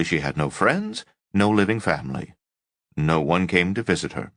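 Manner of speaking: usual speaking voice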